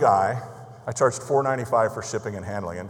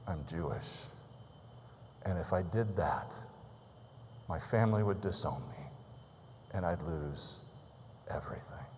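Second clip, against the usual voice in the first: soft confession; 5 semitones lower, 14 dB quieter than usual. Retold or imitated. Friend's painful confession retold softly.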